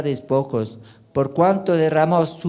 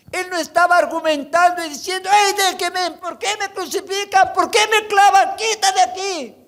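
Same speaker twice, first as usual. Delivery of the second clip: high, loud pulpit preaching that pushes each phrase; full-force preaching; 4 dB louder than usual. Acted. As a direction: preach it with fire to the congregation.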